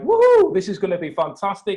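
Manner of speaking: a high, loud whoop of joy; excited cheer. Retold or imitated. Congregation cheering the new pastor's big plan, loud and high. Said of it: "And everyone's like".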